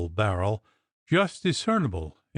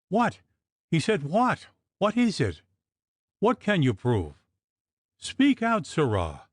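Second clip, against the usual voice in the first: agitated demand; 6 semitones higher than usual. Acted. Agitated man demands the truth.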